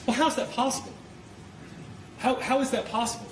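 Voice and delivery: high incredulous rhetorical question. Real, not acted. Rhetorical outrage to jury.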